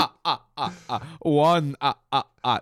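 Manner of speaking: put-on counting with a light vampire accent; Count from Sesame Street. Counting chicken logs like the Sesame Street Count, hammed-up vampire accent. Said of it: "count from Sesame Street".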